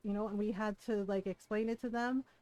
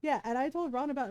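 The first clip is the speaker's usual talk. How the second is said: ordinary delivery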